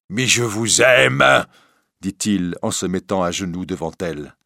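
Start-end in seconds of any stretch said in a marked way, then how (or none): 0.1-1.5 slow, raised, drawn-out declaration; desperate plea. Acted. Priest kneeling before the bohémienne, pleading desperately. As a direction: plead loudly, stretching the words.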